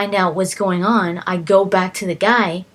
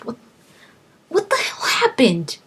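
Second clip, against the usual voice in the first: whispered, higher disbelief; stunned whisper; 6 semitones higher than usual. Retold or imitated. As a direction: breathe it out in shock. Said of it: "I'm just like".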